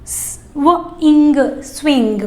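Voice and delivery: स, वो, इंग stretched slowly, then स्विंग; teacher slowing the blend for children; slow blend demo. Shown as a demonstration. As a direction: build स्विंग slowly from its sounds. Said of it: "स्विंग बोलना है".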